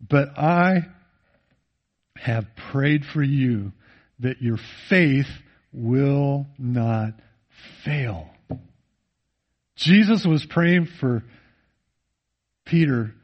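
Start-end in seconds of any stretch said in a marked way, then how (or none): 0.1-8.3 tender hush. Acted. Quoting Jesus' prayer for Peter.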